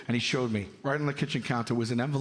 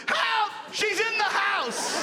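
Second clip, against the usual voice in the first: much higher, panicked yell for help; panicked kid yell; slower than usual. Retold or imitated. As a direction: scream for dad, stretching the last words. Scared kid calls for dad.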